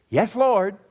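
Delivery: a high, loud Yes Lord for the congregation to echo; eager response. Shown as a demonstration. Preacher leading the church in answering God, high and loud. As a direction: call it out so they answer with you. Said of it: "Say it with me".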